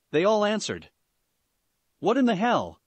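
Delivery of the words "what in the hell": startled, raised-pitch exclamation; surprised. A narrator's reading. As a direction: blurt it out in shock. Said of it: "completely surprised by the fact".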